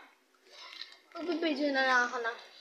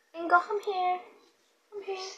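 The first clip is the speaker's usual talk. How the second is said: calling a dog; 6 semitones higher, 4 dB louder than usual. Real, not acted.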